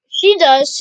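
loud sudden outburst; blurted. Acted. Sunny's friend blurts it out loud to Marcia. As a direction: burst out loud defending her. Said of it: "Sonai blurted".

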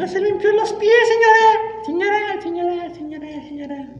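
high nagging repeated señora, fading out; squeaky voice mimicking a nagging worker; mocking shrill employee. Mocking pushy cleaning staff.

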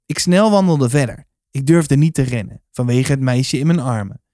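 usual speaking voice

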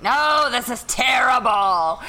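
loud, high, drawn-out protest; mock outrage. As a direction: exclaim it slowly, half laughing. Acted argument with her son.

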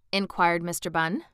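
ordinary delivery, nothing marked

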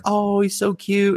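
high, drawn-out cooing; gushing mom. Retold or imitated. Mom falls for the cat, high slow gushing. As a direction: coo slowly, way up in pitch. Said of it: "And she's like".

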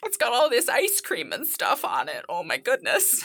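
high, tearful comic voice; hammed-up weeping wife. Wife given a used tissue, comically tearful. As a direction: fuss through put-on tears, pitched high.

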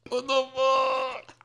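high, slow, stunned shout; shocked shout. Pretend. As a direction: yell like waking up concussed. Acting waking up concussed.

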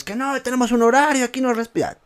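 high-pitched mock telling-off; retold yelling. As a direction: mimic a scolding teacher without full volume. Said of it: "me ha, me ha gritado". (regaño imitado en voz aguda)

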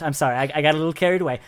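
fast sheepish apology; quick embarrassed sorry; rushed apology. Acted. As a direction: hurry through the excuse.

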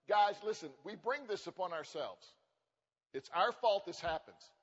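normal talking voice

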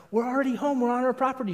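high-pitched mock protest; retold protest. Acted argument with wife.

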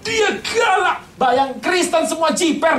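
high, near-shouted punch; fierce emphasis. Acted. Preacher stressing how fierce Saul was, shouting. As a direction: hit it hard and high like a bark.

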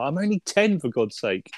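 high, whiny mock child voice; kid's protest. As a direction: squeak it out like an indignant ten-year-old. Jojo refusing Hitler's cigarettes.

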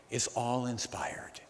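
slow whispered emphasis; hushed whisper. Real, not acted. Preacher whispers to the congregation that scripture is all inspired. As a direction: drop to a whisper to land the point.

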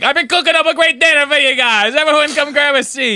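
high, loud dinner call; hammed-up army cook. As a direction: holler like a mock chow-hall cook. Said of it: "Joe's like".

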